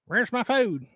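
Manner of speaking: high, put-on pet voice; demanding pet voice. Poochie wanting to be fed, demanding pet voice.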